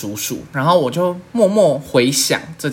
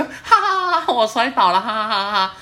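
high giggly mock cackle; laughing while retelling her drunk falls; imitating drunk laughter; 6 semitones higher than usual. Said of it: "我还一直笑".

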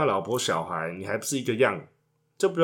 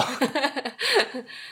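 high, falling laugh; laughter; 13 semitones higher than usual. Real, not acted. Couple laughing at his bedroom joke, high giggles.

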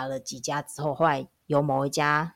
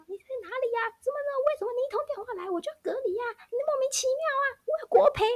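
mock hysterical yelling, much higher and faster; hammed-up panicked rant, pitched up high; imitating a frantic yell; faster than usual. Husband ranting at the contact tracer, high frantic mock yell. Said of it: "他就是一直大喊".

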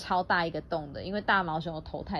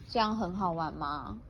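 usual speaking voice

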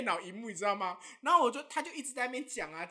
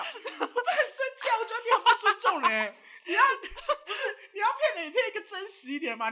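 mock indignation; 8 semitones higher, 5 dB louder than usual.

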